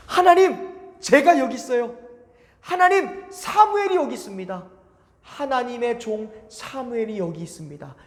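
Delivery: put-on eager boy rushing to the priest; young Samuel. 사무엘 응답. (높고 큰 어린 사무엘 목소리)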